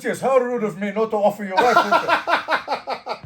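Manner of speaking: high, loud, slow mock apology; mock-earnest courtesy through laughter; imitated deadly-serious host. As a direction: play the gracious host while cracking up. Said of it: "He was deadly serious".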